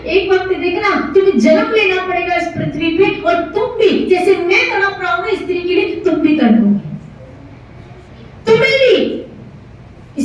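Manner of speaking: high-pitched, driving angry pronouncement; furious curse. Acted. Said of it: "इतना गुस्से में आ गए". (क्रोध भरे ऊँचे स्वर में श्राप)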